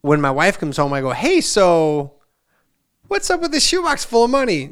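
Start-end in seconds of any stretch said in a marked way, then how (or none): 1.1-4.7 much higher, louder confronting question; incredulous dad. Retold or imitated. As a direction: demand an answer from the wife. Confronting his wife.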